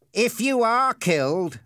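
slow, high-pitched mock reasoning; drawn-out bully's taunt; cruel Ernie, taunting. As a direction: toy with the victim. Ernie taunts the tied boy.

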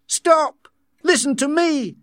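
high, loud urgent command; shouting. A narrator's reading. Savage stopping the soma handout, urgent shout. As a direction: shout to halt a crowd. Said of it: "he shouted".

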